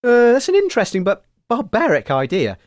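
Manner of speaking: ordinary talk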